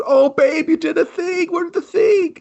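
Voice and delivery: very high, hammed-up squeal; giddy mock excitement. Groom to his new fiancée in a skit, hammed-up giddy. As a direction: squeal it like an overexcited sweetheart.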